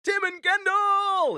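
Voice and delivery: high, loud, drawn-out American announcer; big American stage intro, stretched long; stupid American accent. Retold or imitated. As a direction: belt out the names like an American MC. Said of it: "stupid American accent".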